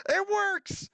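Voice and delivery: very high, thrilled squeal; excited squeal. Real, not acted. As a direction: squeal with sudden joy. First success of the build.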